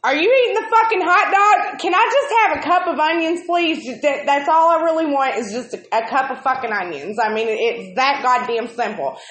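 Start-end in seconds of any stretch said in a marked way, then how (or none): 0.0-3.8 fast, higher, louder exasperated demand; irritated outburst. Retold or imitated. Fed-up customer snapping at the window staff, fast and loud. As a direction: fire it off at the clueless staff.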